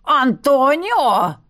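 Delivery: медленно, протяжно, с воем; взвыть имя на весь коридор; взвыл. Acted. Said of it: "взвыл гнусавый". (гнусавый гость воет имя соперника)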